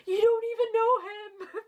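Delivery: high mocking yell, hammed up. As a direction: shriek it like a petty teen girl. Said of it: "Paige yelled".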